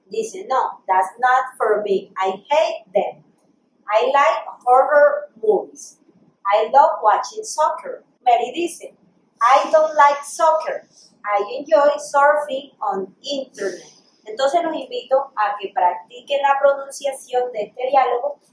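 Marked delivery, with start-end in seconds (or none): 0.5-8.0 slow, measured English line reading; pronunciation model. Shown as a demonstration. Teacher reads Peter's answer about movies, slow pronunciation model. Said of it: "una vez más voy a hacer la pronunciación". 9.4-13.9 slow, deliberate textbook English; pronunciation model. Shown as a demonstration. Teacher reads Mary's reply about soccer, slow pronunciation model. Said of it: "una vez más voy a hacer la pronunciación".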